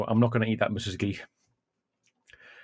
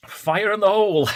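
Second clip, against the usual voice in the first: higher, louder call as the heat hits; fire in the hole; 10 semitones higher, 4 dB louder than usual. Real, not acted. Streamer to chat before the pepper hits, excited higher call. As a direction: announce the burn to viewers before it lands. Bracing before the hot bite.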